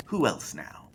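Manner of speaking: whispered sly invitation; spindly man whispering to the group; creepy whisper. Acted. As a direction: breathe it out conspiratorially. Creepy host invites next.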